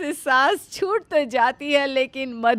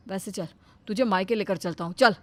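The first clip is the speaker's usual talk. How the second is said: lower, cheerful son coming home; a lighter male son's voice, lower; 7 semitones lower, 6 dB quieter, faster than usual. Acted. Son arriving home, sniffing the cooking, pleased.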